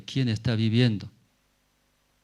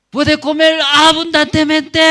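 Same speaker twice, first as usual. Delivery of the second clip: very high, loud, sustained proclamation; full shout; 13 dB louder than usual. Shown as a demonstration. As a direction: yell the good news up to the city. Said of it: "Y gritando él".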